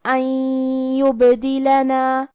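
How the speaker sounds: "ان یبدلنا" chanted slowly, the n merged into a long nasal doubled y; idgham with ghunnah. Shown as a demonstration. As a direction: hum the n into the y of "ان یبدلنا". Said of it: "یا میں ملا کے غنہ اور تشدید". ("ان یبدلنا" میں نون کو یا میں ملا کر غنہ)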